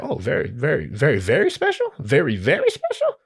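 mock-intrigued questioning, rising at the end; intrigued echo. Flattered performer echoes the host's intro, mock intrigued. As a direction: savor the praise as a question. His reaction to being hyped.

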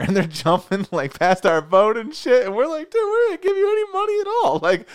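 high, loud, excited storytelling. Real, not acted. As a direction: bubble over with excitement.

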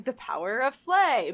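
slow, drawn-out heroic catchphrase; Winston from Overwatch. Acted. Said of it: "like, the way Winston would go".

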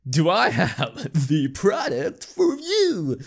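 high, drawn-out mock sales pitch; hammed-up pitchman. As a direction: sell it big, stretching every word. Acted sales pitch opening.

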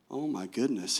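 low, very quiet mock astonishment; acted quiet disbelief; hushed shock. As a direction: play stunned, under your breath. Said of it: "he's shocked".

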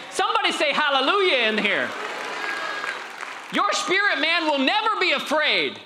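high, loud preacher's cry; full-force preaching. Acted. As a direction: lift the room into a shout. Preaching climax.

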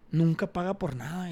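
usual speaking voice

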